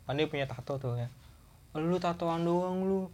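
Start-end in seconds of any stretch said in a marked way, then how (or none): 1.7-3.1 scolding. Retold or imitated. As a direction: shame your tattooed friend for crying. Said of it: "Gua kata gitu".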